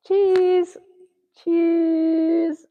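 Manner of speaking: very high, sing-song cheese; high playful cheese; squeaky cheese. Pretend. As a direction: chirp cheese up high like posing for a photo. Posing, saying cheese.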